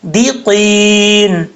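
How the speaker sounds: دیقین with a short thin di and heavy qaf; slow demo of دیقین. Shown as a demonstration. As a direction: say دیقین slowly, di not de. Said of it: "دے نہیں پڑھنا".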